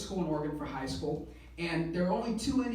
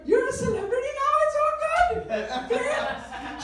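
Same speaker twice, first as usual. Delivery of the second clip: high, loud imitation of a thrilled mother; gushing mom; 8 dB louder than usual. Mom to son she thinks is now famous, gushing excitement. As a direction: play Mom bursting with pride, pitched way up.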